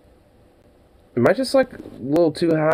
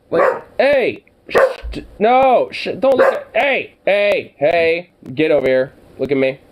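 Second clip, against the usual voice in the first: higher, louder calls at a pet; scolding the dog; 5 semitones higher, 3 dB louder than usual. Real, not acted. Owner scolding the dog to come over, raised voice. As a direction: call the dog to order.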